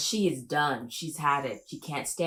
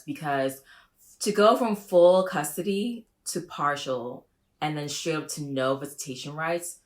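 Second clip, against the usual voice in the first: normal talking voice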